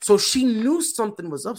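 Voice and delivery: ordinary delivery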